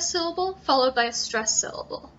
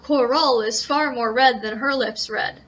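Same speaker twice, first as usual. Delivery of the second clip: Coral is far more red than her lips red read louder, the beat stumbling; forced meter; 4 dB louder than usual. Shown as a demonstration. Teacher showing the broken meter. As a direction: stress it until it clunks. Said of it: "That doesn't work".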